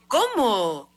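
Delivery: one stretched, swooping exclamation; shocked disbelief. Retold or imitated. Woman told she must pay before leaving, shocked. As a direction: re-enact disbelief, pitch leaping then falling. Shocked reaction to ambulance demand.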